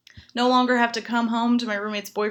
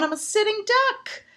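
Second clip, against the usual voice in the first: much higher, squeaky self-pity; high squeal; 10 semitones higher than usual. Acted. To the crowd, a high mock panic squeal. Punchline about living alone.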